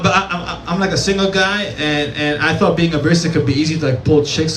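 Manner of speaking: ordinary delivery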